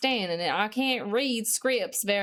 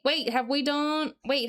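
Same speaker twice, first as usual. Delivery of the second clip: ordinary talk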